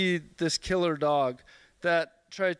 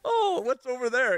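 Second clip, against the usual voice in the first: breezy high deflecting voice; fake casual; 4 semitones higher than usual. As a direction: brush it off, change subject. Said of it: "do the ignore thing".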